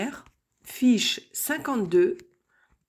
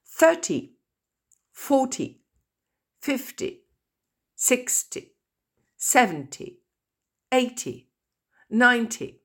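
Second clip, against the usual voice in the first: Thirty punched high on the first syllable; first-syllable stress; 4 semitones higher than usual. Shown as a demonstration. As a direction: lean on the front of each tens number. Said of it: "accent tonique sur le pr-, la première syllabe".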